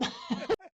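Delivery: short high-pitched laugh. Real, not acted. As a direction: laugh it off lightly. Laughs at own story.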